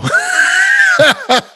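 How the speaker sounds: a high, loud laugh of disbelief. Real, not acted. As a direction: bark out a laugh at the mess. Host reacts to pandemic timing.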